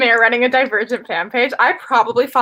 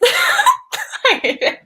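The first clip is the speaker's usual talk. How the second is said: laughing, high; 7 semitones higher, much slower than usual. Real, not acted. Group groans over bad kiss scene.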